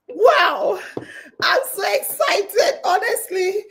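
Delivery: excited exclaim with laughter; real excitement. Real, not acted. Host greeting the live audience, giddy and laughing. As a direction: burst out with giddy joy.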